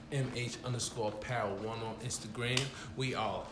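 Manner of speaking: usual speaking voice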